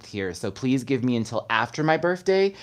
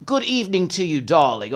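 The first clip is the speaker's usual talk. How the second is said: higher, playful hint of British accent; mock British greeting; 5 semitones higher, 4 dB louder than usual. Host greets London viewer, half-hearted British accent. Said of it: "That was not a British accent".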